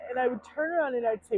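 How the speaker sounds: usual speaking voice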